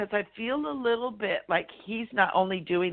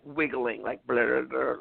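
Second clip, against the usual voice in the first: ordinary delivery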